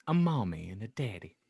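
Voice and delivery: normal talking voice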